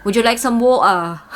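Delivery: water as "wo-ah", no T or R; exaggerated dropped R. Shown as a demonstration. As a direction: overdo a British "water", swallowing T and R. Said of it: "don't emphasize the R word".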